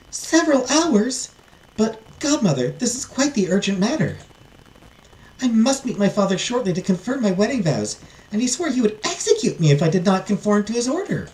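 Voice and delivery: high, pleading princess voice; urgent heiress, pitched well up; dismayed princess. Acted. Princess pleading with godmother over urgent matter, dismayed high voice.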